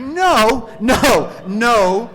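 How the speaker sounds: loud, high cries of protest; panicked refusal. Retold or imitated. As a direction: shout each word in alarm. Panicked refusal to deliver baby.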